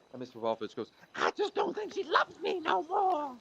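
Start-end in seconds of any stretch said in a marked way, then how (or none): 1.1-3.4 very high, slow, mournful moth; sad moth voice. Acted. Moth complaining to the foot doctor, very high and mournful. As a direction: wail like a heartbroken moth. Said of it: "What is that voice?".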